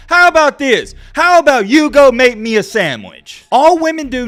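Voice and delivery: loud, high-pitched retort; heated rant. Real, not acted. Vlogger snaps back at complaining women, loud and high. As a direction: fire it back at them, loud.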